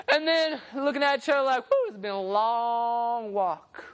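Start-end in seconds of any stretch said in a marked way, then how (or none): 1.7-3.8 lightly acted tired, drawn-out delivery; acted weariness. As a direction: stretch it slowly like a worn-out walker. Disciples weary after the climb.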